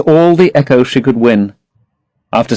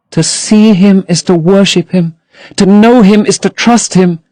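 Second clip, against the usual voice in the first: put-on higher, dreamy young girl; Sybil in love; 8 semitones higher than usual. A narrator's reading. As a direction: gush about her lover to her brother.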